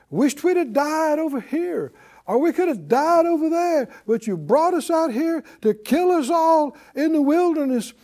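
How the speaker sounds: high-pitched acted grumbling; griping complaint. Israelites complaining about dying in the wilderness, griping. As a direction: gripe it out, pitched well up. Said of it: "griping and complaining".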